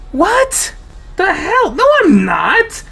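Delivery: high, loud disbelieving protest; shocked denial. Acted. Denying accusation in shock.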